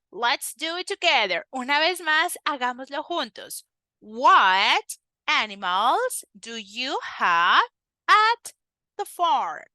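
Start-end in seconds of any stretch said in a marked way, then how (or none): 4.0-9.6 slow, word by word; slow halting drill. Shown as a demonstration. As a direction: spell it out slowly for the child. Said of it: "with more fluency".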